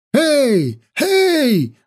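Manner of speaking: high, urgent hailing cry; yelling. A narrator's reading. Soothsayer runs downhill hailing the prince, yelling. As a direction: cry out high to stop someone. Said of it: "вопил он".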